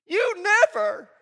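loud, high, slow accusing "You never"; accusing shout. Shown as a demonstration. Pastor demos a blaming spouse, loud accusing shout. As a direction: fling "You never" hard at them. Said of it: "words we should always eliminate".

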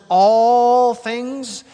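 "all things" punched high and loud; stress on ALL THINGS. Shown as a demonstration. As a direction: hit "all things" hard, it's emphatic. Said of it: "is in the original emphatic".

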